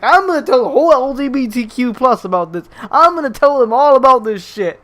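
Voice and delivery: indignant mock shouting; mock outraged threat. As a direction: imitate a customer storming out yelling. Mimics customer storming out.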